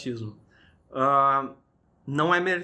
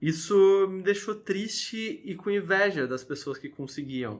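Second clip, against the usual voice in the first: normal talking voice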